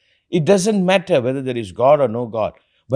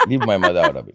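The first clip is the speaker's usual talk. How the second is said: laughing protest; 7 semitones lower than usual. Real, not acted.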